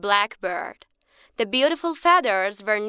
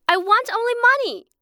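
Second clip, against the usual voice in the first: higher, quick greedy demand; greedy insistence; 12 semitones higher, 4 dB louder, faster than usual. Acted. Greedy man cuts off the bird, quick and high. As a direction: snap out your one want.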